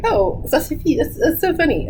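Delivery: lightly imitated friend, pitched high; mock amused friend. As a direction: echo the phrase as if tickled by it. Friend amused by her French phrase.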